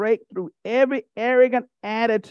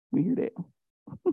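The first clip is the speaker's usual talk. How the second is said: slow, much softer, drawn out; knowing aside; 3 semitones lower, 7 dB quieter, much slower than usual. Acted. Preacher teasing the ladies in church, slow and soft. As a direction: let it hang, knowingly amused.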